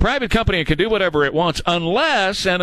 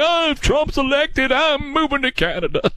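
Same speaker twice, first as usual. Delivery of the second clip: mock whining; 11 semitones higher than usual. Mocking celebrities threatening to leave.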